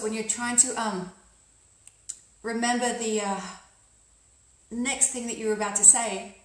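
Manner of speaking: hesitant searching, long gaps after "um" and "uh"; unconscious pauses. Shown as a demonstration. Coach acting a speaker losing the thread, halting pauses after "um" and "uh". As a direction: stall after "um" and "uh" as if the next words escaped you. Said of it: "found yourself in an unconscious pause".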